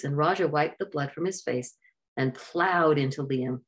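ordinary delivery

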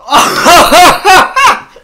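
high, loud joyous laugh; triumphant laugh. Real, not acted. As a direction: burst out laughing in victory.

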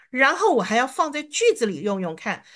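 ordinary talk, nothing marked

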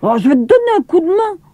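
lightly voiced mischievous brother; a hint of an eager boy. A narrator's reading. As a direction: rattle it off, eager to help. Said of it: "dit Émile".

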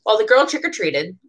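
ordinary delivery, nothing marked

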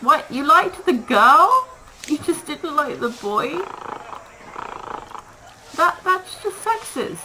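high, slow, outraged disbelief; mock outrage. Player to the fussy animals who rejected the boy, mock outraged. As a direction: play up the shock, stretched out high.

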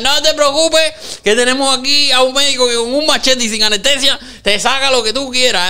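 mock quack doctor reassuring a patient; mock reassuring pitch. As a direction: lure them in like a quack. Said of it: "te dirán".

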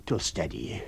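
calm him down with a drink; Mr Hall, soothing husband. Acted. Husband comforting his frightened wife.

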